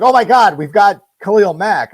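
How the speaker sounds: high, loud oh my God; a fan hearing huge trade news, loud and high; excited disbelief. Retold or imitated. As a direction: blurt it out in sheer joy at the trade news. Retold trade news reaction.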